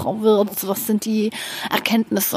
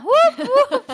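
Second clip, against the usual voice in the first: excited cheer; 4 dB louder than usual. Acted. Birthday cheer repeated.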